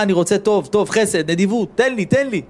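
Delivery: rising, pleading high call that builds; soul crying out. Retold or imitated. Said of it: "הנשמה שלו צעקה".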